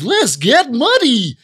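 the American voice actor's version, high and slow; other actor's Muck. Retold or imitated. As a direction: mimic the replacement actor's take. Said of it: "So someone goes".

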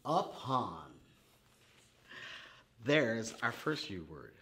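normal talking voice

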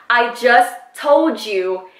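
slow, deliberate retort; acted slow exasperation; exasperated. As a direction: space the words out as if out of patience. Retold exasperation at repeating herself.

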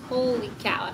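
ordinary talk

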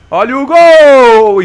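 loud, high, slow goal shout; explosive goal commentary. Real, not acted. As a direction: belt it out like a stadium announcer.

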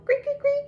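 very high cricket greet; high chirping cricket call. Pretend. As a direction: squeak it like a tiny cricket. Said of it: "The crickets go".

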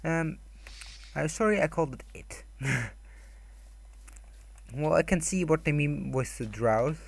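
usual speaking voice

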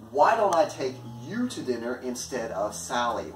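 "you" stretched and emphasized; stress on YOU. Shown as a demonstration. Choosing you over Sally, stress on "you". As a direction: make "you" the contrast with anyone else. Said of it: "The focus is on the".

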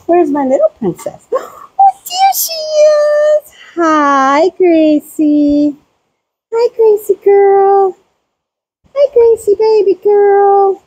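very high, slow cooing voice. Real, not acted. Gasping with delight at baby goat Gracie, high coo.

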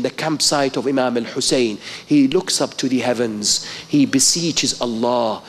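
ordinary delivery

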